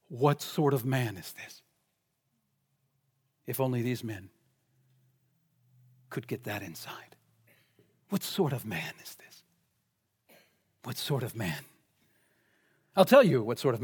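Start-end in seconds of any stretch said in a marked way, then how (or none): 0.1-1.6 low, slow and soft wonder; hushed awe. Acted. Disciples marvel after the storm is stilled, hushed awe. 3.4-11.6 very soft, low near-whisper; hushed wonder. Acted. Preacher dwelling on the disciples' question, hushed wonder.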